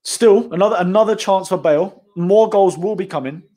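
excited. Real, not acted. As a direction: call it with building hype. Another chance for Bale.

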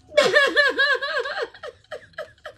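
shrieking burst of laughter; real high cackle; high loud laughter. Real, not acted.

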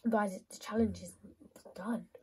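soft unvoiced whisper; whispered aside. Real, not acted. Gamer whispering about the challenge. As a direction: whisper it to yourself.

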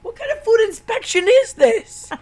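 high, loud comic dad squawk; mock dad voice. Retelling the dad's outburst at the food inspector, in a high loud squawk. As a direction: bark it out like an outraged dad. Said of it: "He's like".

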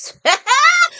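shrill cackle shooting high; high mocking cackle. Real, not acted. Mocking enemies she calls clowns, high cackle. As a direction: cackle up at them in scorn.

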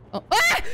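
high, loud startled scream; sudden loud shriek. Real, not acted. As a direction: yelp as if something jumped out. Jump scare in game.

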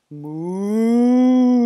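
long, loud moo, rising; ginormous moo. Acted. Dudong letting out a ginormous moo. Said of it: "let out a ginormous".

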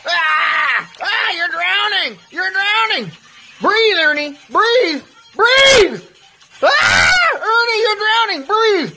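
high, strained screams of alarm; panicked screaming. Acted. Instructor yelling at drowning Ernie to breathe, panicked high screaming. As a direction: scream as if someone is going under.

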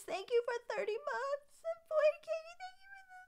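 rising to a tiny high squeak; squeaky overwhelmed thanks. Real, not acted. Streamer thanking subscribers, voice rising into a squeak. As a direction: let the voice climb and shrink to a squeal.

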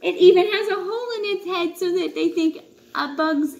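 high, laughing through the words; giggling while explaining the hole. Real, not acted. Parent telling kids about the leaf katydid, laughing.

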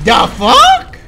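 high, loud disbelief; shocked shout. Real, not acted. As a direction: yell it in pure shock. Shocked at boss.

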